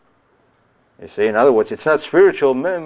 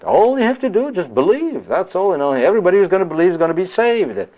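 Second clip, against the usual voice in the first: much higher, sneering parody voice; mocking preachers; 7 semitones higher than usual. Preacher parodying other preachers to his congregation, sneering mock voice. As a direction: lampoon the easy-faith sermon. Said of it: "A lot of preachers preach that".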